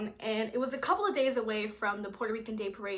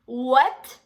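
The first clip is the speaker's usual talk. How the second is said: louder what, swooping up sharply; incredulous what; 10 dB louder than usual. Retold or imitated. Girl hearing mom will pick her up, incredulous. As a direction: burst out in disbelief. Stunned reply, said twice differently.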